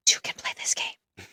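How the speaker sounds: breathy conspiratorial whisper. Real, not acted. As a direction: hush it like a secret threat. Silent spam caller on phone.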